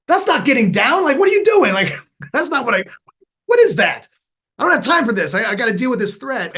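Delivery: high, loud, exasperated rant; frantic scolding. Retold or imitated. Dad panicking at daughter in frog stance, high exasperated scolding. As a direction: scold her in a panic, no time to waste.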